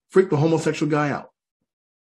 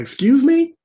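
loud, high indignant question; getting angry; 12 semitones higher, 7 dB louder, slower than usual. Acted. Gunman challenged by the alien, bristling with anger. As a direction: pitch jumps up in mock offence. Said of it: "He's getting angry".

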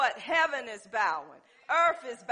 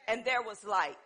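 ordinary talk, nothing marked

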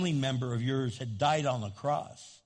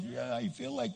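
retold frustration; 9 semitones higher than usual. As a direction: mimic her venting at God in a high voice. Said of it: "you gotta be angry at God".

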